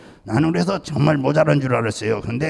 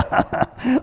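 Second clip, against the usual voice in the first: quick unvoiced chuckle; short breathy laugh; 10 semitones higher than usual. Real, not acted. Pastor laughing at his own Gyeongsang husband story with a breathy chuckle. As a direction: laugh at the punchline.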